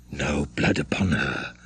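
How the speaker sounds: low, soft, deep aside; the bell-ringer speaks low, sparing the girl; undertone. A narrator's reading. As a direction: mutter it under the breath. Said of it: "said he in an undertone".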